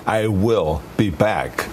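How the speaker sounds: slow, evenly spaced machine delivery; measured robotic suggestion. Retold or imitated. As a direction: separate "I will" instead of "I'll", word by word. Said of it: "Sounds more like a machine".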